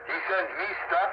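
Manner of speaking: usual speaking voice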